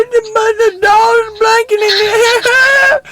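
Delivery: high-pitched terrified shout; panicked scream. Retold or imitated. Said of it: "I was freaking the hell out".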